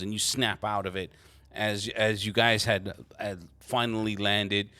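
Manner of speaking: usual speaking voice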